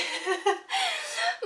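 high laugh. Real, not acted. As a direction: crack up at the memory. Laughing while retelling the jump.

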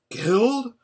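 high, loud one-word question; breathless shock. A narrator's reading. Listeners fear their friend has died, breathless shock. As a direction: blurt it out, pitched far up in alarm. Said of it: "said three voices breathlessly".

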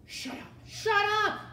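high, loud angry shout; frantic silencing. A narrator's reading. Rat silencing the princess and Mig, frantic shout. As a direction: shout them down, rising to a yell.

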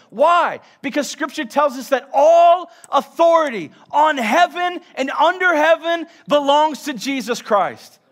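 loud, high, driving sermon climax; full-force preaching. Acted.

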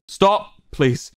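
high cry of stop, dropping to a plea; shout. A narrator's reading. Ranbu raising a hand to halt Wilbur, shout. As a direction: call out to halt them, then ease off. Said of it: "he shouted".

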